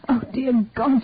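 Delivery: usual speaking voice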